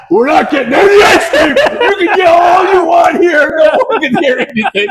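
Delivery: loud, high mock rant through laughter; retold yelling. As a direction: re-enact a dad's outburst, laughing as you shout. Father retells yelling in locked car.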